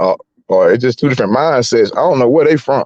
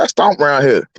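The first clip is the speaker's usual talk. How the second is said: defiant retelling; 5 semitones higher than usual. Retold or imitated. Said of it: "I told my class, I said".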